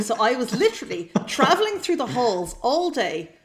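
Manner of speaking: usual speaking voice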